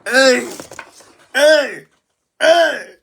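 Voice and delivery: rising ecstatic cheers; shrieking jackpot winner. Real, not acted. Cheering as the speech ends.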